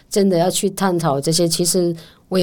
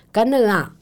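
干了啊 said higher with the last syllable pushed hard; stress on the end; 7 semitones higher than usual. Shown as a demonstration. Teacher drilling students to stress the end of 干了啊. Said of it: "你后面一定要重一点".

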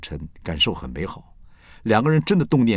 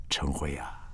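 whisper; 5 semitones lower, 10 dB quieter, slower than usual. Real, not acted. Quiet aside to absent friend.

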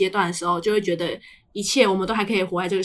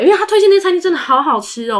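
raised and louder, gushing about the food; excited; 8 semitones higher, 7 dB louder than usual. Real, not acted. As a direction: rave about how good it was. Raving about restaurant. (兴奋地夸餐厅好吃)

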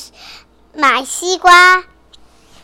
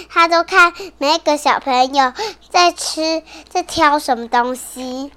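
usual speaking voice

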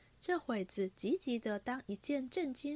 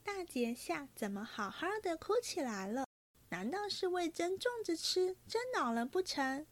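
higher-pitched, lilting mock concern; playful teasing; 7 semitones higher than usual. A narrator's reading. Young lady teasing the tearful pair, playful mock concern. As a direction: tease lightly with swooping pitch. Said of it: "黛玉笑道".

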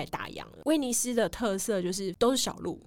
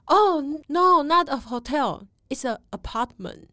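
imitating the guide's English; 5 semitones higher, 5 dB louder, slower than usual. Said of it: "他就说".

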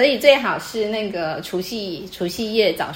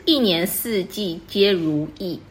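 reads each syllable slowly and evenly, like reciting verse; slow couplet recitation; slower than usual. Shown as a demonstration. Teacher reciting a couplet example to the student, slow and measured. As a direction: savour the couplet one word at a time. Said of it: "有对联是".

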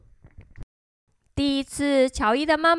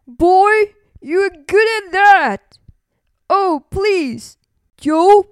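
loud, higher bear voice; begging; 5 semitones higher, 6 dB louder than usual. A narrator's reading. Bear asks Joe for a lesson, begging. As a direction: plead loudly with Joe. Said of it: "he begged".

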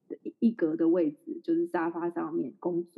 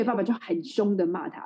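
normal talking voice

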